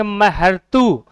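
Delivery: महर्तु stretched syllable by syllable, very slow; drawn out slowly. Shown as a demonstration. Teacher sounding out the word for students, very slow. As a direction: spell महर्तु out slowly for the class. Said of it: "शब्द को बोल के दिया".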